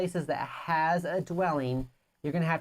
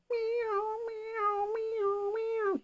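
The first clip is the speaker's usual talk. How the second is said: high meowing cat noises; cat meows; 4 dB quieter, much slower than usual. Acted. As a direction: meow like a cat. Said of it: "we gotta do the noises".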